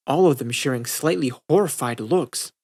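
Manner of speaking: ordinary delivery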